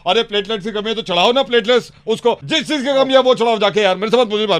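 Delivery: high, fast irritated outburst; exasperated. Acted. Doctor scolds assistant over repeated questions, irritated. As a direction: scold the assistant for pestering you.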